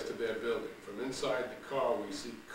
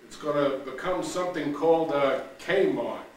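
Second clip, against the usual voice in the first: Frank's announcement; 3 semitones higher, 7 dB louder than usual. Acted. Frank's quote.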